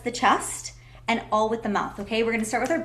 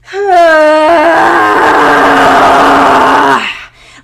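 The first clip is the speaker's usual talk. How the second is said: long loud groaning sigh; 7 semitones higher, 15 dB louder than usual. Shown as a demonstration. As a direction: let out a huge release sigh. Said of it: "give it a voice".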